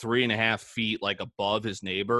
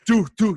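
loud, high-pitched punch noises; imitated punch sounds; 11 semitones higher, 8 dB louder than usual. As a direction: voice two quick mock blows.

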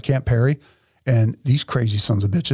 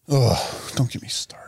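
fed-up whisper; 3 semitones lower, 4 dB quieter than usual. Real, not acted. Fed-up aside about Beto.

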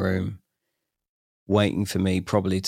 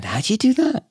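much higher mock-amazed mates; mates marvelling; 3 dB louder than usual. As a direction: ham up the mates' disbelief. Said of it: "all my mates are going".

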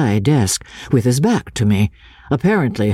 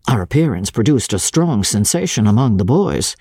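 ordinary delivery, nothing marked

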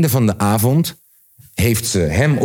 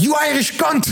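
high-pitched mock yell; acted-out outburst, voice shooting up high; retold shout. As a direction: imitate a man yelling an insult. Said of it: "begon hij te schreeuwen".

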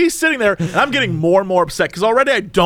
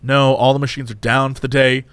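low, flat drive-through worker; mild weary cashier voice; tired employee; 7 semitones lower than usual. Retold or imitated. Cashier answering angry customer, low and weary.

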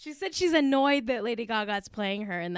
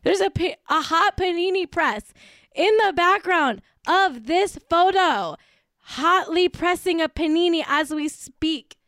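higher, louder, incredulous gushing; emphatic raving; 5 semitones higher, 4 dB louder than usual. Real, not acted. Fan marvelling at a panini press in a photo, emphatic raving. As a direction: hammer out each phrase in amazed delight.

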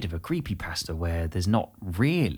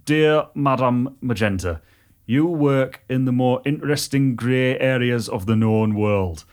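put-on slow Teesside accent reading; Redcar accent; 3 dB louder, slower than usual. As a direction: northeast England accent with a bit of Yorkshire. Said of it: "I'll give it a go".

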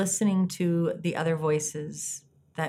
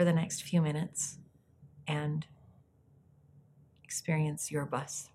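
soft spa whisper; 7 dB quieter than usual. Acted. Leads a guided meditation.

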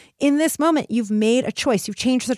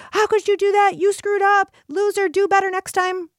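much higher and louder, scolding and contemptuous; harsh berating coach; 10 semitones higher, 3 dB louder than usual. Retold or imitated. Coach berating a bowler who screwed up, harsh scolding. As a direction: bark at the bowler like a coach who has lost patience. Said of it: "the coaches were like".

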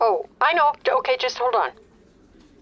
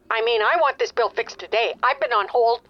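ordinary talk, nothing marked

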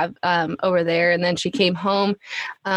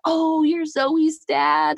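much higher, slow, delighted stranger; stranger recognizing Jesse; 9 semitones higher, much slower than usual. Retold or imitated. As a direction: stretch it out like a surprised acquaintance. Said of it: "Jesse gets stopped, like".

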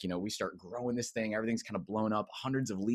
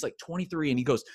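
ordinary talk, nothing marked

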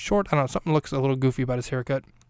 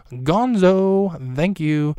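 calling out; 4 semitones higher, 6 dB louder, much slower than usual. Real, not acted. Calling out to Gonzo.

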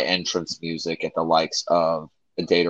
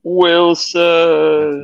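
drawn-out, high movie cry; Tom Hanks impression; 10 semitones higher, 4 dB louder than usual. Co-host quoting the Castaway movie to the host, drawn-out mock cry. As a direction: call out long and high for a lost companion. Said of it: "the movie Castaway with Tom Hanks".

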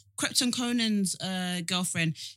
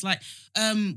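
ordinary talk, nothing marked

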